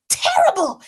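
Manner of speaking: ordinary delivery, nothing marked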